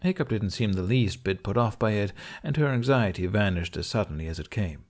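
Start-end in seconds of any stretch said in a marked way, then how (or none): none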